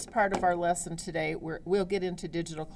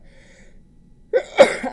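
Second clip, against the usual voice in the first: a second loud sneeze; big burst of sneezing; 14 dB louder than usual. Real, not acted. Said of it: "a little bit of springtime weather".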